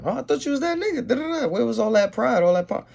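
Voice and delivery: mock him in a high jeering voice; girl turning down a bragger, high and sarcastic; sarcastic taunt. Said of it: "it's mockery and it's, uh, sarcasm".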